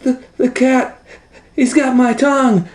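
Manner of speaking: very high squeaky mouse voice, stammering; squeaky panicked butcher. Acted. Butcher mouse squeaking that the cat has his tongue. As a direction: squeal it in a tiny frightened voice.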